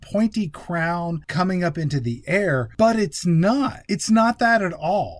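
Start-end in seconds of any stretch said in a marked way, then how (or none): 2.8-5.2 raised, emphatic exasperation; worked-up film-critic rant; exasperated. Real, not acted. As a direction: hit each word in disbelief at the cheat. Exasperated rant about fake-out.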